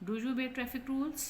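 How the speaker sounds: high, slow question climbing on "traffic rules"; rising yes-no question. Shown as a demonstration. Teacher demonstrating a yes-no question, voice rising to "traffic rules". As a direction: push the pitch up through "traffic rules". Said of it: "उसमें भी हमारी आवाज़ जो है वो ऊपर जाएगी".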